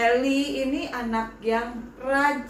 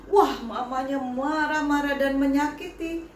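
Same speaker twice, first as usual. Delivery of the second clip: usual speaking voice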